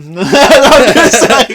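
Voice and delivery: ordinary talk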